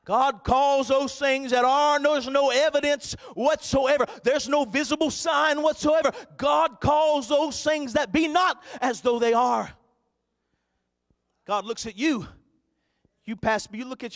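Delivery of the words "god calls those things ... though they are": high, loud, slow declaration of faith; full-force preaching. Acted. Preacher declaring to the church, in full-force preaching voice. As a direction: proclaim it to the congregation.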